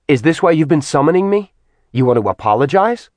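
fast, sharp angry question; quick, scornful male fury; angry challenge. Acted. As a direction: throw the question at her in contempt. Acheron confronts Artemis angrily.

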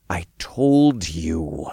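slow, held-in anger; seething. A narrator's reading. Hero answers the accusing Archangel, seething. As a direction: stretch each word, seething. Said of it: "I seethed".

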